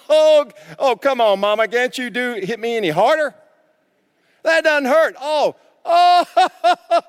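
high, loud mock bravado of a spanked kid; mock taunting kid. Preacher mocking a spanked child taunting his mama, high and loud.

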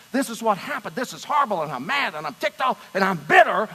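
loud, whiny grumbling list of grievances; griping complaint. Retold or imitated. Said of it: "gripe and grumble and complain".

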